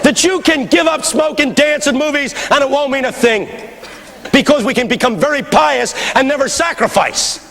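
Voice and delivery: high, ringing sermon delivery; full-force preaching. Real, not acted. Preacher challenging a congregation on sacrifice, forceful high preaching. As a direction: preach it hard, pitch held high.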